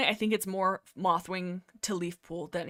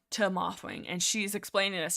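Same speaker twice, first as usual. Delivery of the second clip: ordinary delivery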